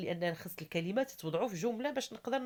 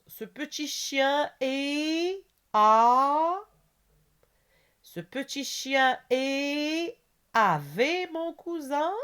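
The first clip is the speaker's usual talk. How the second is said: very slow, high, loud reading with stretched words; teacher's drawn-out, projected read-aloud; slow exercise dictation; 8 semitones higher, 7 dB louder, much slower than usual. Shown as a demonstration. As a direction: dictate it word by word, holding the gaps open. Reads exercise sentences aloud.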